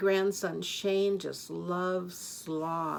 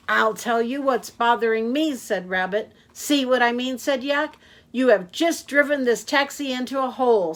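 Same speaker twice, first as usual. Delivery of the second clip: exasperated Rabbit; 4 semitones higher, 7 dB louder than usual. Acted.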